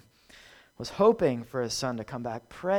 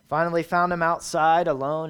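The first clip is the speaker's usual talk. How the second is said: normal talking voice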